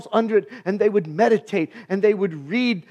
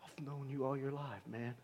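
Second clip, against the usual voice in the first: much softer and lower, gentle; soft words; 10 semitones lower, 23 dB quieter than usual. Acted. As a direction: speak it tenderly, barely above a breath. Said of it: "soft words of".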